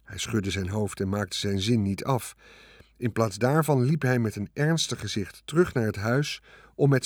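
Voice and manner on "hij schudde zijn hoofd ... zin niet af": ordinary delivery, nothing marked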